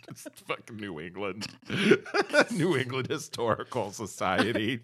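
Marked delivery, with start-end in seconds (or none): none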